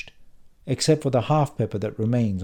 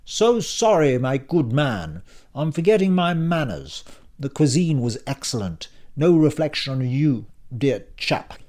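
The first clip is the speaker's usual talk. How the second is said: mock-polite posh English, raised pitch; Oxbridge received pronunciation; 5 semitones higher, 4 dB louder than usual. Tsar apologises to his host in put-on Oxbridge English. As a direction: put on an Oxbridge accent, loud and mannered. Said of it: "using Oxbridge received pronunciation".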